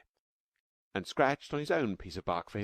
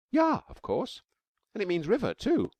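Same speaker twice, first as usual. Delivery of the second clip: high, loud eager answer; excited little girl; 7 dB louder than usual. Acted. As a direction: pipe up, thrilled to know it.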